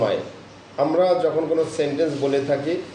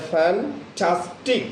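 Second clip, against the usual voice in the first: Fantastic slow, with weight on the middle ঠাস; stress on tas; 3 semitones higher, much slower than usual. Shown as a demonstration. Teacher modelling fantastic for students, slow and stressed. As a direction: stretch fantastic and push the middle syllable. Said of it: "এই শব্দটা আমরা বেশি বেশি উচ্চারণ করে যাচ্ছি".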